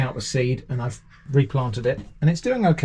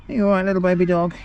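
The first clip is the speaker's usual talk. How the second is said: worried parent; 4 semitones higher, faster than usual. Real, not acted. Soothing the scared dog.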